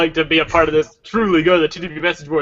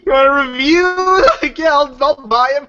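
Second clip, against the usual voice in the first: mock outrage; 11 semitones higher than usual. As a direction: squeal it out indignantly. Mock outrage about review.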